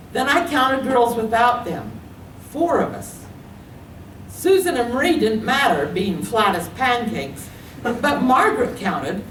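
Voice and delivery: usual speaking voice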